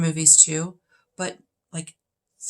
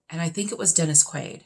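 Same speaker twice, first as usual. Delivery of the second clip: ordinary delivery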